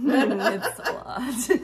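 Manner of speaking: slow, laughing it out. Real, not acted. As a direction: giggle through the words. Laughs at kid story.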